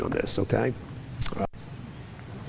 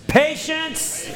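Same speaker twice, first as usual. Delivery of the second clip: "patience" thrown out high and a little louder; 14 semitones higher than usual. Shown as a demonstration. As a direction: call out "patience" so the church answers loud. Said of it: "You gotta say that loud".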